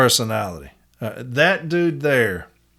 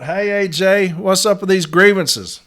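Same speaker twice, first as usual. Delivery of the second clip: called out; 6 semitones higher than usual. Retold or imitated. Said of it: "Somebody yells out".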